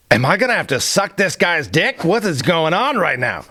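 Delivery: comic dread with swooping pitch; retold panic. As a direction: fire off mock-panicked questions to yourself. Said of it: "and I'm panicking".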